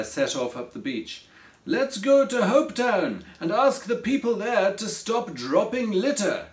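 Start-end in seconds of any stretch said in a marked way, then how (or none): 1.7-6.5 raised, higher storybook shout; mild call to a friend. A narrator's reading. Pierre to Craig up the beach, calling out. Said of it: "he shouted to Craig".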